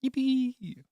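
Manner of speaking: playful high yippee; mock yippee. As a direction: squeal with glee. Cheering game item.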